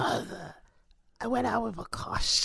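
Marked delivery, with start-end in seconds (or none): 1.2-2.4 lightly gruff Irish imitation; softer, mock-gruff take on the actor; Liam Neeson voice. Friend mimics the actor's confession, lightly gruff imitation. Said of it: "it was gruffer. You gotta do it gruffer".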